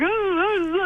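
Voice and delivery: high mock crying wail; imitated wailing sob. Imitating Katie sobbing as the tormented girl, high wail. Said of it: "sobbing and screaming and crying".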